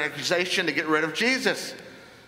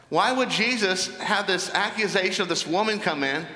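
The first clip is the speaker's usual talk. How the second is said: ordinary talk, nothing marked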